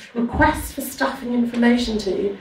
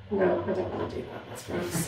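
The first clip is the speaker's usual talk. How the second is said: muttered disgust; 5 semitones lower, 13 dB quieter than usual. Real, not acted. Disgusted dismissal, much softer.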